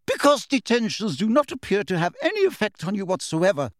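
high, tart teacher's retort. A narrator's reading. Professor retorts to Harry, tart. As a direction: cut him off with a tart reply. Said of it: "said Professor McGonagall tartly".

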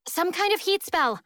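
high, urgent call; suggesting shout. A narrator's reading. Darby shouts a suggestion to the guitarist, high call. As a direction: call it out, pitched well up. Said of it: "Darby shouts".

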